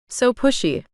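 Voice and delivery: joking. A narrator's reading. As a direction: tease them gently. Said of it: "he joked".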